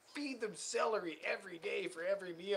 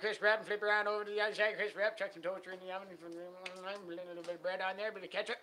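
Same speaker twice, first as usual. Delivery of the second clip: rapid-fire Newfoundland patter on one pitch; mock Newfoundland accent; 3 semitones higher, 4 dB louder than usual. Giving a bologna frying recipe, hammed-up fast Newfoundland accent. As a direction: rattle it off like a Newfoundland cook, starting loud.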